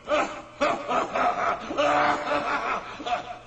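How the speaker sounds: mocking laugh at the boy; scornful laugh. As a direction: laugh at the tiny challenger. Gigante ri ao ver Davi. (risada de escárnio)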